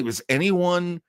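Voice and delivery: usual speaking voice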